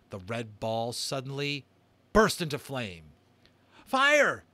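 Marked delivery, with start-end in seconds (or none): 3.8-4.4 high joyful shout; excited yell. Acted. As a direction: shout it out in triumph. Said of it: "he yelled".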